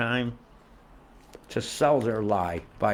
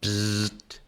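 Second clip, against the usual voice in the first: buzzer sound. Pretend. Buzzer noise for wrong.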